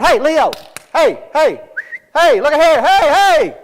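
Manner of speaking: loud, high shouts to get attention; calling out. Retold or imitated. Family shouting at a lion to turn round, high attention-getting holler. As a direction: holler repeatedly, pitch climbing.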